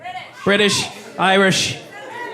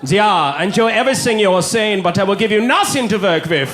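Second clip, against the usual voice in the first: put-on German accent. German tourist voice continued.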